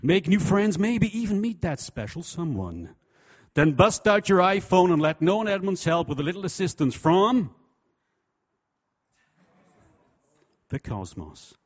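hammed-up American infomercial accent. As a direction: sell it like a loud American TV ad. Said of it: "I'll do an American accent".

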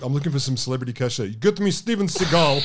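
fast mock Russian accent; hammy Russian Putin voice. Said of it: "your Russian accents".

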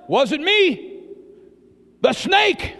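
high-pitched, hammed-up protest; mock blame-shifting. The woman blames the snake to God, high mock protest. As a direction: pass the blame with a high exclamation.

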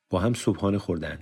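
ordinary delivery, nothing marked

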